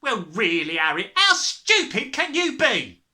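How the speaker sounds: high, loud scolding; crying out. A narrator's reading. Mother berating her foolish husband, crying out. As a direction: scold him at full tilt. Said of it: "the mother cried".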